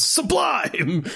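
high, drawn-up mock holler; mock yell. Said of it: "he just yells".

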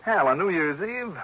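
can't believe he's asked tonight; incredulous. Acted. Johnny protests to Hal about leaving on New Year's Eve, incredulous.

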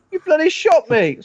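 high, indignant yelp; shrill indignant. Retold or imitated. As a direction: squeal in outrage. Shot man complains.

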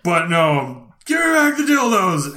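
high put-on shout; mock yell. Clerk arguing with the thief again, high mock shout. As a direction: demand it back, hammed up. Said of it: "we argue again".

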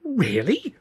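high, rising disbelief; astonished. A narrator's reading. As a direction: ask in wide-eyed wonder, pitch up. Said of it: "eyebrows shooting up in astonishment".

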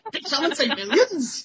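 high, soft voice with a laugh; laughing imitation of the greedy dad hearing about millions; greedy dad popping up. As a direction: pop in eager for money, half laughing. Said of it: "Daddy Gabor Bucks pops up".

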